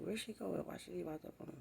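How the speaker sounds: very soft, hurried muttering; hushed private wondering; to herself. Retold or imitated. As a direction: whisper your worry quickly. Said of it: "I'm saying to myself".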